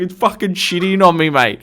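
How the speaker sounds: high, loud retold complaint; indignant protest. Tradesman to cat owner while being shat on, loud indignant protest. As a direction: protest loudly as the outraged victim.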